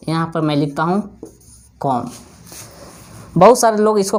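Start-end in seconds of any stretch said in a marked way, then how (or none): 1.8-2.1 कॉम with b silent, ending on m; कॉम rhymes with home, b dropped. Shown as a demonstration. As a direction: say कॉम, no b at the end. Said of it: "इसको बोलेंगे कॉम".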